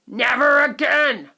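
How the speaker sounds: loud, slow firm shout. A narrator's reading. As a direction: put his foot down. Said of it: "shouted Mister Teavee".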